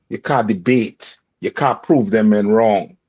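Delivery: ordinary delivery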